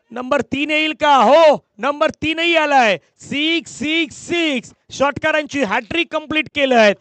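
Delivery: high, drawn-out call of a six; live cricket play-by-play. Real, not acted. Commentator calls a hat-trick of sixes to the crowd, excited play-by-play. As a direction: stretch the call out high for the big hit.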